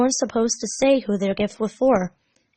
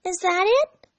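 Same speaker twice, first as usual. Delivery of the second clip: put-on higher teasing girl question; high girlish prodding; probing Amy; 11 semitones higher than usual. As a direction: nudge him with a question.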